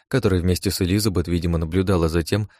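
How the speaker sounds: plain reading voice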